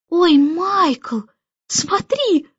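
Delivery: put-on high-pitched excited little girl; delighted cry. A narrator's reading. Girl urging her brother to look, delighted cry. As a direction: squeal it with rapture, without shouting. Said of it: "восторженно закричала Джейн".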